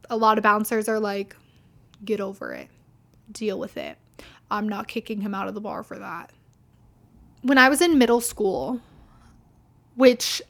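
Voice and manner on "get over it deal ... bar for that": low, quiet brush-off; lightly voiced bouncer who won't bother; dismissive bouncer.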